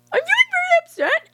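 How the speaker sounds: hammed-up high hurt voice; mock wounded whine. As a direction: whine it like a crybaby. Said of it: "they're like".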